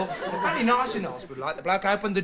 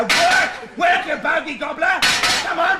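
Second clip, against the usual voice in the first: much higher, louder shouts; frustrated yelling; 8 semitones higher, 5 dB louder, slower than usual. Real, not acted. [name] yelling at the clock that won't work, angry. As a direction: bark at the clock to make it work.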